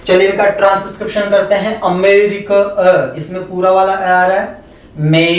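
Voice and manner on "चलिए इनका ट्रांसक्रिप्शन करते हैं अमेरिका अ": normal talking voice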